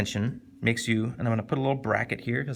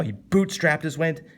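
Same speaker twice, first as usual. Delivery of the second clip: "bootstrapped" hit hard, higher; punchy correct tone; 4 semitones higher than usual. Shown as a demonstration. Teacher correcting the tone of the line, punched "bootstrapped". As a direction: punch the first syllable of "bootstrapped". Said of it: "It doesn't sound like you should say it like".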